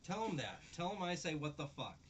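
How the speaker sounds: teasing friend Mike with a half-serious loud rant; real mock-angry yell. Real, not acted. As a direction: bark it out in joking fury.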